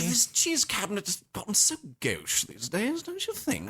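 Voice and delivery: high, fussy society complaint; affected high-pitched fussing; hammed-up snob. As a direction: put on airs, lilting upward at the end. Posh bit about cheese cabinet.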